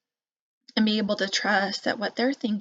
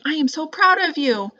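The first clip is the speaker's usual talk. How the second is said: raised, loud proud praise, stress on proud; 7 semitones higher, 6 dB louder than usual. Shown as a demonstration. Parent showing the praise to avoid, proud and loud. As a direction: beam with pride. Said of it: "I'm not saying".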